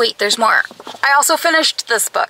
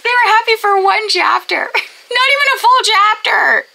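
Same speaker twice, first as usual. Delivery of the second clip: high, exasperated protest; indignant outburst; 7 semitones higher than usual. Real, not acted. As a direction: protest in disbelief at the injustice. Outraged over the plot.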